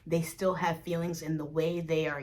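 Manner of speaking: normal talking voice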